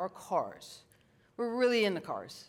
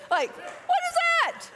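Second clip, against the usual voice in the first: mock disbelief; 6 dB louder, slower than usual. Mocks her own reply.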